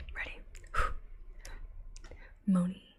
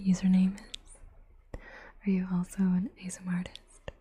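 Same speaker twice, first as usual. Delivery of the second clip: normal talking voice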